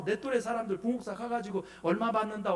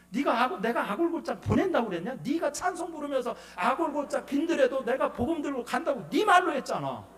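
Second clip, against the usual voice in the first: loud, raised-pitch retold scolding; 하나님; 4 semitones higher, 4 dB louder than usual. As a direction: throw their own promise back at them, building to a louder finish. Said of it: "하나님이 막 책망하시는 거예요". (높고 큰 소리로 따져 묻듯 책망)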